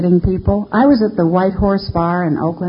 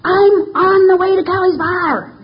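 put-on drunk indignation; 13 semitones higher than usual. Drunk driver protesting to the cop, high and indignant. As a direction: play the outraged drunk who can't see why she's stopped. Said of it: "I said".

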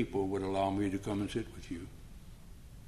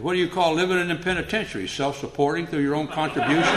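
sarcastic retort; 5 semitones higher, 4 dB louder than usual. Retold or imitated.

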